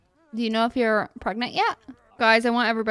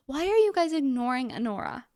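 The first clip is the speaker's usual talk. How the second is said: ordinary talk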